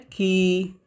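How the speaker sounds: की with a long held ee; pronunciation demo. Shown as a demonstration. Teacher showing students the pronunciation, की held long. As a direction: draw out the vowel of की. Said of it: "इसका उच्चारण हम लोग करते हैं".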